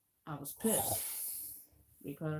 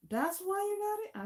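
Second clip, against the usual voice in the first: high, louder disbelieving question from the aunt; surprised aunt; 13 semitones higher, 5 dB louder than usual. Retold or imitated. Aunt asking her niece about the Rock Band gift, high and surprised. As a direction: squeak it out like you can't believe it.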